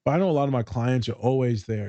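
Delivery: ordinary talk, nothing marked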